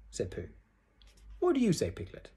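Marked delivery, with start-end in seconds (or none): 1.4-2.3 high, lightly voiced question; curious friend. A narrator's reading. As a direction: ask a small friend with interest. Pooh questions Piglet in story.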